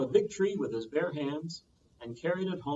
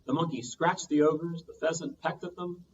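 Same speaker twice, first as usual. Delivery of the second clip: ordinary delivery, nothing marked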